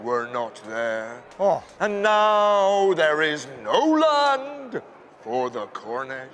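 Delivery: low, slow, booming voice of God; mournful almighty God, drawn out low. Acted. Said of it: "says God".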